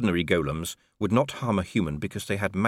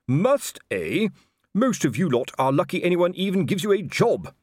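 higher, louder spluttering rant; furious shout; 9 semitones higher, 4 dB louder than usual. A narrator's reading. Colon ranting at Constable Shu, red-faced and shouting. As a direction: rage at the insolent constables. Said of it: "and shouted".

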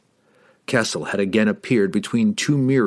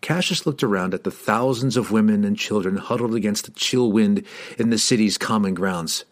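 plain reading voice

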